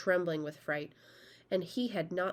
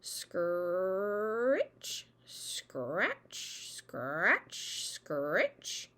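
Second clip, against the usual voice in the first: hoe scratching sounds; much slower than usual. Acted. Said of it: "the noise of a hoe".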